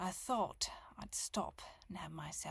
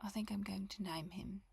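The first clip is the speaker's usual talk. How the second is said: ordinary delivery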